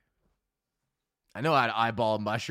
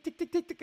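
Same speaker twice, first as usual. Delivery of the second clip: roller coaster ticking. Retold or imitated. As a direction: tick like a coaster climbing the lift. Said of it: "the roller coaster's going tick".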